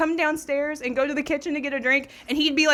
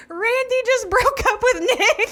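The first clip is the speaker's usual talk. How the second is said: laughing; 13 semitones higher, 8 dB louder, slower than usual. Retold or imitated. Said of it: "and she says".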